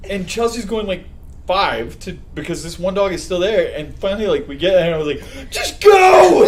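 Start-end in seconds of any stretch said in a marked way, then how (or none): 5.5-6.4 high, loud, drawn-out retold yell; acted-out frantic holler; urgent shout. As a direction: shout it to get the driver moving. Retold urging driver past the dog.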